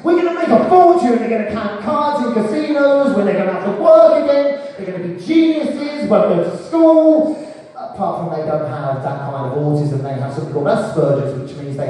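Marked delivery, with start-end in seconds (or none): 0.0-7.4 high, loud, greedy glee; rubbing hands at a get-rich-quick plan, high and loud; gleeful scheming. Retold or imitated. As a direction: gloat like you've struck gold, pitched high and loud. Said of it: "rubbing my hands together thinking".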